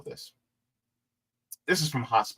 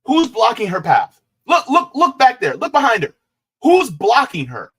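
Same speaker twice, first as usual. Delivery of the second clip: louder, higher outraged calling; exasperated shout; 6 semitones higher, 7 dB louder than usual. Real, not acted. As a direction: yell it at the screen in disbelief. Angry at the woman's claims.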